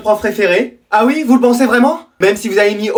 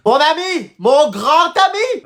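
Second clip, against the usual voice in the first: higher, slow drawn-out calling; higher and slow, a mock friendly call; sing-song greeting; 6 semitones higher, much slower than usual. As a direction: call to the friend, stretching each word.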